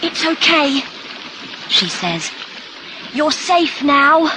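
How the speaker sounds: high, urgent reassurance; relieved, high-pitched comforting; reassuring. Acted. Rescuer reassures the boy.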